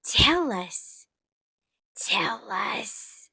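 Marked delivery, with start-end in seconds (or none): 2.0-3.3 teasing whisper. Pretend. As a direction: breathe it out conspiratorially. Teasing whisper after normal take.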